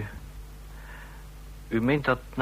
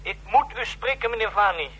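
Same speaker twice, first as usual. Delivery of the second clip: anxious pleading; 7 semitones higher than usual. Acted. Distressed late-night call.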